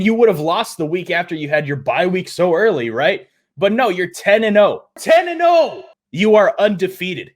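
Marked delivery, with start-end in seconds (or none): 5.0-5.8 high, loud disbelief; exasperated shout. Acted. Vlogger shouting the team's record at the Steelers, loud and incredulous. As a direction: shout the record back at them.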